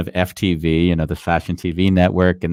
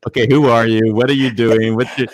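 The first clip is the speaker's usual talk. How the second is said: higher, louder quizzing voice; mock interrogation; 4 semitones higher, 5 dB louder than usual. Friends examining his date at movie night, mock interrogation. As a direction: imitate friends firing questions at a newcomer. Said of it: "everyone sort of examined her".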